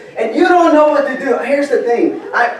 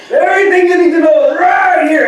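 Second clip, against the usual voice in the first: mock preacher declaring it much higher and louder; preachy proclamation; 7 semitones higher, 4 dB louder than usual. Quoting older preachers to young listeners, mock booming proclamation. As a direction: boom it out like an old-time pulpit preacher. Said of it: "they say things like".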